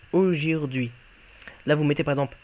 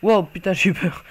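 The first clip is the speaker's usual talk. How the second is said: real startled yelp, louder; 2 semitones higher, 6 dB louder than usual. Real, not acted. A player scares himself in game, loud startled cry. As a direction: jump at your own scare and blurt it out. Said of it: "je me suis fait peur à moi-même".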